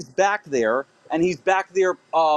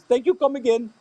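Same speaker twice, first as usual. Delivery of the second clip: lightly put-on Indian accent, slightly higher; mild imitation of an Indian accent; 4 semitones higher than usual. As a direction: try a half-hearted Indian accent. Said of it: "That's what, Indian?".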